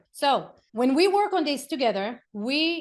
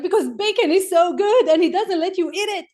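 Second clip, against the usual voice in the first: put-on high, louder, childlike complaint; little son's voice; 7 semitones higher, 8 dB louder than usual. Mom imitating her son in the back seat, high childlike complaint. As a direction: play a five-year-old indignantly explaining about bacon. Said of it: "And he's like".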